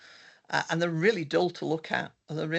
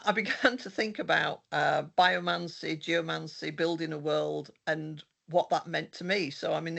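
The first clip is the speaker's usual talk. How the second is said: usual speaking voice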